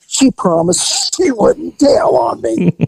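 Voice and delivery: slow mock whine. Mimicking dealer's whine.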